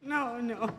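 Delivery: ordinary talk, nothing marked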